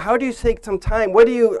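ordinary talk, nothing marked

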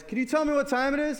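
quick, high, eager question; cheery jogger. Pretend. Jogger asking the sleepy driver the time, high and quick. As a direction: fire it off like a jogger running in place.

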